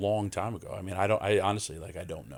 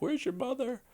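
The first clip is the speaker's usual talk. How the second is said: imitating grandma. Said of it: "and she's like".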